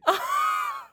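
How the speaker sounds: shrieking laugh. Real, not acted. Bursts out at the joke.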